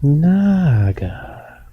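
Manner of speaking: high, long swooping first vowel; drawn-out word. Pretend. Playing with a dangerous word, drawn out. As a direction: stretch out NAAAga, falling at the end.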